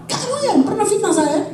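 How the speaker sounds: high-pitched mock accusation; hammed-up indignant challenge; mock confrontation. Acts out confronting a slanderer.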